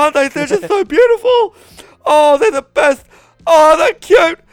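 high, loud gushing parent voice; doting parent. Retold or imitated. Mocking parents gushing to friend over their kid, high gushing coo.

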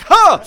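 high, loud indignant huff; sharp high outraged scoff; mock huff. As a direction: huff in pretend rage. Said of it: "be like".

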